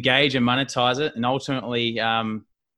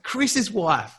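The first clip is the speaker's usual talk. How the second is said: higher mock wife voice; imitating wife; 9 semitones higher than usual. Wife explaining who Elsa is, higher imitated voice. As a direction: lift into her excited tone.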